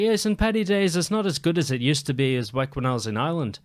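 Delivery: lightly imitated Irish accent, starting high. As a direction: keep up a put-on Irish accent. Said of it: "I gotta keep the accent".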